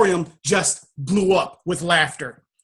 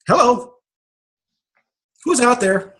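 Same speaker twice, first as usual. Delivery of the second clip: higher, calling into the dark; 5 semitones higher than usual. Retold or imitated. Man on a dark stage calling out to the unseen voice, higher. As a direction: call out to an empty hall.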